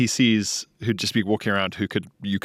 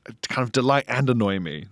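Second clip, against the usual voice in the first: ordinary talk, nothing marked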